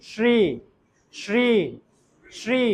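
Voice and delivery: শ্রী with a sh, "shree", tongue touching the palate; palatal sh. Shown as a demonstration. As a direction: repeat শ্রী slowly apart, each starting sh. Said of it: "touch করতেছে কিন্তু". (শ্রী-তে তালব্য শ, জিভ তালুতে)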